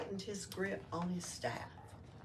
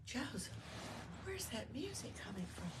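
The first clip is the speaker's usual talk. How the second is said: higher, soft whisper of a just-woken wife; 6 semitones higher, 11 dB quieter than usual. Acted. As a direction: breathe it out sleepily, wondering. Mary waking, asks softly.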